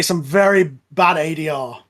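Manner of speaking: ordinary talk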